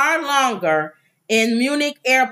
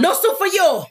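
Soup Nazi; 8 semitones higher than usual. Retold or imitated. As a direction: slam the door on the customer. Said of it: "the soup Nazi".

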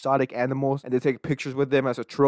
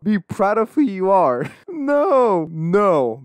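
laughing through the words, much higher and louder on no; real laughter breaking into the read; cracking up at the tweet; 4 semitones higher, 4 dB louder, much slower than usual. Real, not acted. Laughs reading absurd tweet.

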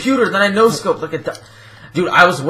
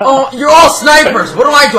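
loud, higher-pitched complaint; exasperated yell; 5 semitones higher, 5 dB louder than usual. Real, not acted. Player beset by snipers, exasperated shouting. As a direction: yell helplessly at the whole lobby.

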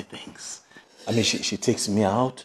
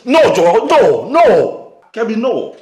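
high, loud outburst of protest; angry refusal; 10 semitones higher, 12 dB louder, much slower than usual. Acted. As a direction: shout down the suggestion. Son refusing in a quarrel.

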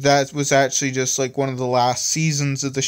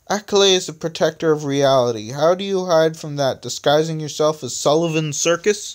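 raised, louder sarcastic question; 4 semitones higher, 5 dB louder than usual. A narrator's reading. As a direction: needle them with a mock question. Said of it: "asked the disembodied voice of Electronic sarcastically".

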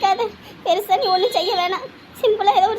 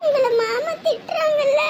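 slow, higher, crying voice; tearful; 4 semitones higher, slower than usual. Acted. Child upset that aunt was scolded, crying. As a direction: answer through tears, dragging the words. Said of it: "நீங்க ஏன் சாமி அழுதுட்டு இருக்கீங்க".

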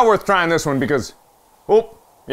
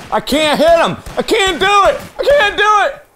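high, hammed-up panic; panicked despair; 11 semitones higher than usual. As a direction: wail helplessly, pitch climbing. Panicking while missing targets.

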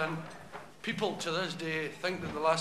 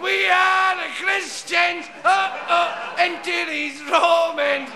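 put-on much higher, louder, slow drunken chant; drunken bawling; 9 semitones higher, 9 dB louder, much slower than usual. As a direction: bawl it like a pub singalong. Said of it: "singing and shouting and bawling".

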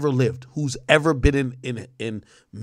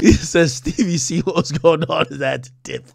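slow words broken by laughter; laughing; 2 semitones higher, 4 dB louder than usual. Real, not acted. Streamer repeating the Stevie joke to viewers, laughing. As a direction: laugh your way through reading it.

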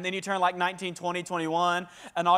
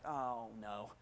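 "Oh, no" dropped to a near-whisper, much softer and lower; dismayed under breath; 11 semitones lower, 17 dB quieter than usual. Retold or imitated. Student reacting to the professor's jokes, muttering in dismay.

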